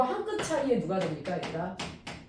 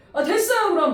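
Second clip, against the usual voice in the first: loud high huffy retort; husband brushing her off, loud and high; husband's angry dismissal; 8 semitones higher, 8 dB louder than usual. Retold or imitated. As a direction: toss it off high and loud in a huff. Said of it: "화를 내는 거야".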